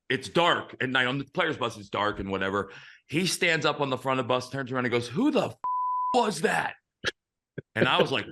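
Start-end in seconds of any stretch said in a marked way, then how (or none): 5.1-6.7 high-pitched outraged demand; imitating a furious coach's challenge; bitter angry coach. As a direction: act out an old-school coach blowing up at the team. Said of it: "John Vukovich was fucking bitter".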